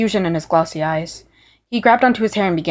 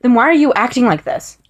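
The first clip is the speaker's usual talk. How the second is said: raised, distressed demand; upset demand; 6 semitones higher, 5 dB louder than usual. Acted. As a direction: push back at him, hurt. Virgil confronts a changed Roman.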